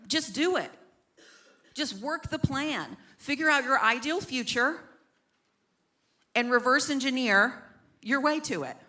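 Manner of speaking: lightly voiced pushy bro; mock the hustle guru; productivity bro. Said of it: "these pro bros, I call them".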